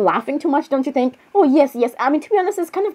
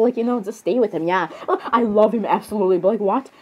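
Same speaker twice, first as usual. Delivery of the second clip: ordinary talk, nothing marked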